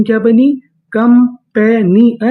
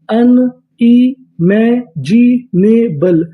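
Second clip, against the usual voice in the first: very slow syllables; 2 semitones higher, much slower than usual. Shown as a demonstration. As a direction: stretch unimaginable out very slowly, syllable by syllable, rising at the end. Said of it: "पूरी meaning".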